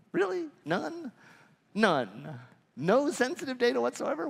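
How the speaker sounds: high, disbelieving "Really? None?"; mock disbelief at a silly claim; incredulous. Speaker mocking a small business owner, high incredulous disbelief.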